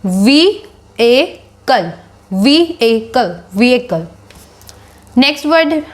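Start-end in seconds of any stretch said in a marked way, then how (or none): 0.1-3.4 "वी ए कल" broken into slow separate syllables; teacher breaks the word apart for students. Shown as a demonstration. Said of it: "So it is pronounced as". 3.6-4.1 "vehicle" rejoined as VEE-uh-kul, no h; teacher rejoins the word after splitting it; pronunciation demo. Shown as a demonstration. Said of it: "So it is pronounced as".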